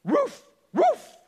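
high barked roof roof; shouted high dog bark. Acted. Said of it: "the dog said".

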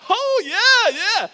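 high, loud whooping yeah; cheering along. Retold or imitated. Dad cheering with his son on the slide, loud whoop. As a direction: join the kid's joy full voice.

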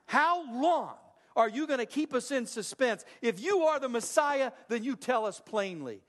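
usual speaking voice

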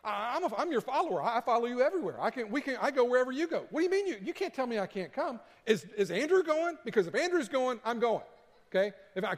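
high, fast, half-laughing protest; a flustered disciple pleading, laughing through it; panicky Peter. Retold or imitated. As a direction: play Peter scrambling not to be left behind. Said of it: "And he's like".

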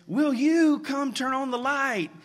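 put-on high, slow child pleading; a small boy begging for the light, pitched high; scared little boy. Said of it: "the little boy screams to his sister".

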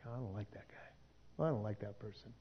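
soft, low scornful mutter; a quiet petty grumble about someone; snide mutter. Retold or imitated. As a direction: grumble it under your breath with disdain. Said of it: "when Christians like".

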